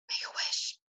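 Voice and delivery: soft, secretive whisper; voiceless, hushed storybook whisper. A narrator's reading. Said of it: "he whispered".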